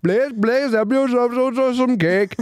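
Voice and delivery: put-on high, slow, pleading Indian accent as the owner; imitated Indian accent. Store owner offering birthday cake to customers, high slow pleading Indian accent.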